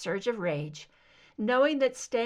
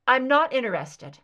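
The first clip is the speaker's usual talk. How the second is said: curt refusal; 2 semitones higher, 8 dB louder than usual. Acted. Angry captive refuses.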